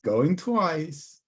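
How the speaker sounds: auctioneer calling for last bids. Pretend. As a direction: call it out like an auctioneer. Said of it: "I'll sound like an auctioneer".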